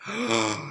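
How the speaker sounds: sharp quiet gasp; shocked gasp. Acted. Disguise revealed.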